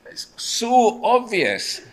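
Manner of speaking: high, drawn-out insistence; emphatically obvious. Pretend. Preacher telling God the answer is plain, high slow insistence. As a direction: stretch it out as if nothing could be plainer.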